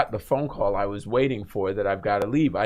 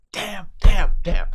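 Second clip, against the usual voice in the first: loud, higher cursing under his breath; frustrated curse; 7 semitones higher, 7 dB louder, slower than usual. Retold or imitated. Driver cursing as police lights appear, loud and higher. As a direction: swear loudly at the blue lights. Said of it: "And I'm going".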